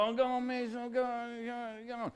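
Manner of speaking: soft, pleading whinge that fades away; wheedling schoolchild, trailing off; whiny kid. Acted. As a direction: beg in a small whining voice. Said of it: "And the kid would go".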